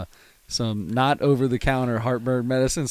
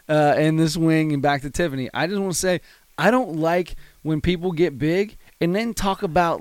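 usual speaking voice

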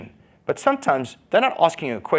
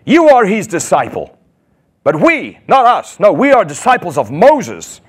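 louder, scornful outburst; abusive retort; 5 semitones higher, 7 dB louder than usual. Retold or imitated. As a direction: shout down the beggar with contempt. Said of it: "They spoke abusively to him".

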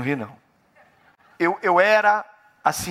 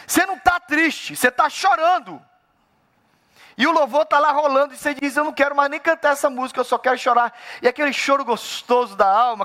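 fervent preaching; 9 semitones higher than usual. Acted. Pastor warning believers, preaching hard. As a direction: lean into each word as a warning.

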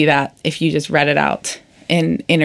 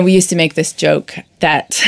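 ordinary talk

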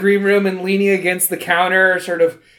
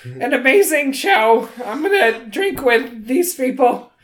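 high, woozy character voice; dazed; 5 semitones higher than usual. Acted. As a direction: ramble on in a pitched-up daze. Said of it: "I'm very dazed".

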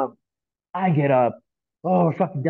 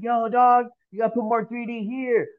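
mock call up; 8 semitones higher than usual. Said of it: "screaming up at their homies".

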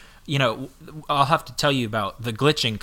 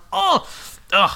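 high exasperated "oh", then a short "ugh"; loud, high cry of exasperation; frustrated groan; 5 dB louder than usual. Retold or imitated. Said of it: "I was so frustrated".

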